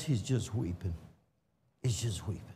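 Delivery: very soft, falling low; hushed. Real, not acted. Preacher to congregation on God weeping, hushed. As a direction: drop almost to silence in awe. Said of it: "preacher describing God weeping".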